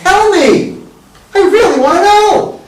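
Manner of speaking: super interested. Shown as a demonstration. Coach demonstrating an over-the-top interested question, high and eager. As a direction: gush with curiosity. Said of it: "My goal is to sound super interested".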